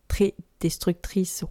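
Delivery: "destructrice" slowly and softly, sound by sound; careful retry. Real, not acted. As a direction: take "destructrice" slowly to get it right. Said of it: "wow, je vais réussir". (reprise lente et appliquée de « destructrice »)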